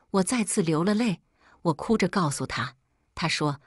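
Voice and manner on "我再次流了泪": usual speaking voice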